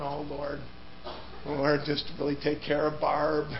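low, hushed last prayer; whispered prayer. Retold or imitated. Preacher recalling his dying prayer for his wife, hushed and low. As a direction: murmur it like your final words. Praying as he expects death.

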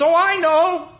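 high, eager, bright idea just struck; perked up. Retold or imitated. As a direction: pipe up like the answer just came to you. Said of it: "perks up".

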